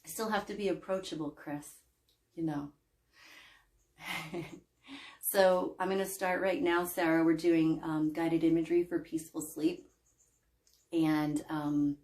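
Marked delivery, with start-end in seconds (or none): none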